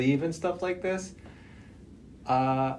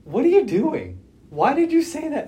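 sharp, higher, louder self-scolding; 7 dB louder than usual. Retold or imitated. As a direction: snap at yourself for a mistake. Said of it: "I was like".

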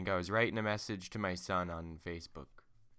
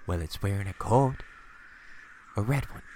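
wife voice; 5 dB louder than usual. Acted. Wife describes goat to husband.

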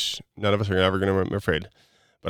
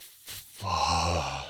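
low, soft sigh of a swear; long exhaled curse; 5 semitones lower, 6 dB quieter than usual. Shown as a demonstration. Coach demonstrates breathing through frustration, exhaled curse. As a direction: let it out on a deep breath. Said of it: "take your deep breath".

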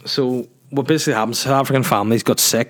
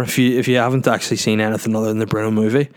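ordinary talk, nothing marked

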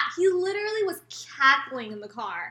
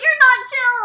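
high, loud contradiction; mock bickering boyfriend, voice raised; retold loud retort; 13 semitones higher, 16 dB louder than usual. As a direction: fire back over the protest. Boyfriend's retort in the acted quarrel.

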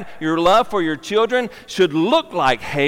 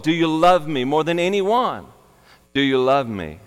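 ordinary delivery

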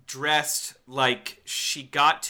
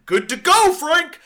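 high, loud mocking voice; mock script approver; 13 semitones higher, 10 dB louder than usual. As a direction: squeak out a studio exec's approval.

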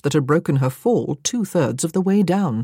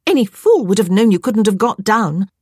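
higher, louder exasperated rebuke; scolding; 4 semitones higher, 5 dB louder than usual. A narrator's reading. Stranded woman berating the cat, scolding. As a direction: tell off a fool, emphatically.

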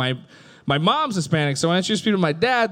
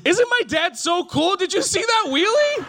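mock proud gushing; 12 semitones higher than usual. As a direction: gush like a starstruck little boy about his dad.

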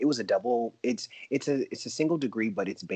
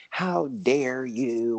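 indignant; 5 semitones higher, 6 dB louder, much slower than usual. Retold or imitated. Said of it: "they didn't give me that".

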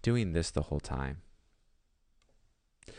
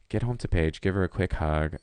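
ordinary talk

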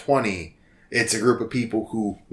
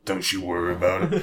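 put-on Old Stan; 2 semitones lower than usual. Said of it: "barbecue sauce commercial redneck voice".

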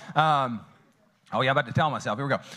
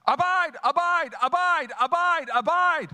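slow repeated abide; 13 semitones higher, 3 dB louder, slower than usual. Acted.

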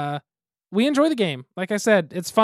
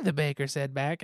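slow, singsong story narration; weird storytelling voice; 3 semitones higher, slower than usual. Shown as a demonstration. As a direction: read it like a goofy fairy tale. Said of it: "really weird storytelling voice".

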